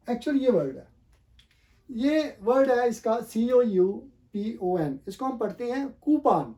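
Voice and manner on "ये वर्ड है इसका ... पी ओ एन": louder, letters drawn out slowly; slow emphatic spelling. Shown as a demonstration. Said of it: "spells the word emphatically".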